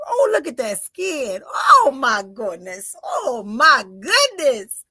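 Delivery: slow, loud, drawn-out wonder; awed delight. Real, not acted. Reader marvelling at a newborn on camera, slow and awed.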